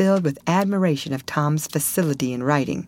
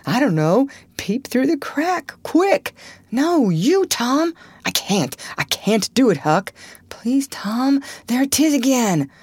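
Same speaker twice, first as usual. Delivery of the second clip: high, panicky back-and-forth; frightened urgency; 8 semitones higher, 3 dB louder than usual. Acted. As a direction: plead urgently as a scared boy. Frightened boys argue over who peeps.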